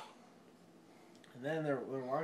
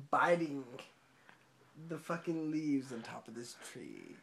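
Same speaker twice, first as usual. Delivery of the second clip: normal talking voice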